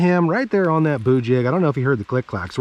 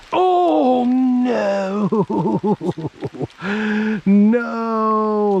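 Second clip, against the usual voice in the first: high, drawn-out cry of no; anguished wail; 9 semitones higher, much slower than usual. Real, not acted. Angler who just lost his fish, anguished wail. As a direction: wail it long, breaking into wobbling laughter. Loses another hooked fish.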